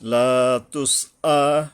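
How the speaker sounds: slow "লা তুস আ" with a strong throat attack on "আ"; firm hamza. Shown as a demonstration. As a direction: recite "লা তুস আ", striking the final "আ" firmly. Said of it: "হলকের গোড়ার থেকে শক্তভাবে আদায় হবে".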